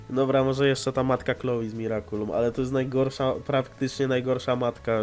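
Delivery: usual speaking voice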